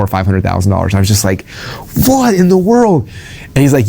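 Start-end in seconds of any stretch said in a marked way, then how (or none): 2.1-3.1 drawn-out, high astonished gasp of words; high, slow what in the world; stunned disbelief. Retold or imitated. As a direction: let it hang in slow shock. Stunned reaction to huge sales.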